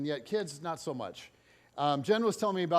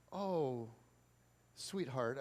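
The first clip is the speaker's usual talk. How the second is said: gentle; 5 semitones lower, 8 dB quieter, much slower than usual. Retold or imitated. Gentle reply to rude child.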